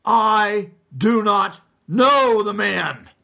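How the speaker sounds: high, loud and slow furious denial; swearing an oath. Acted. He swore an oath.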